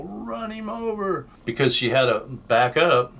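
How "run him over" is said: high urgent cry. Retold or imitated. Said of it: "I go".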